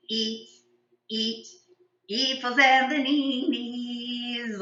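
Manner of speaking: "E like to eat" sung with every vowel turned to long E; slow sung verse swapping every vowel for E; all vowels as E. Shown as a demonstration. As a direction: sing "bananas" as "beeneenees". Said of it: "now an E sound".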